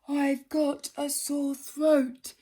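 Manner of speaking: slow, put-on sore-throat voice; hoarse lion. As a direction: croak out an excuse.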